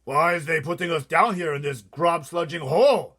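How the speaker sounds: loud, high, furious roar; angry giants shouting. A narrator's reading. Giants yelling up at the BFG, furious shout. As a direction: bellow it up from the pit. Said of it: "they shouted to the B F G".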